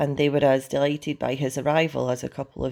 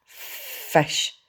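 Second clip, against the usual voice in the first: whispery, drawn-out dragon hiss; 2 semitones higher than usual. A narrator's reading. Toothless demands food from Hiccup, hissing. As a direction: hiss the word slowly without voice. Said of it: "hissed Toothless".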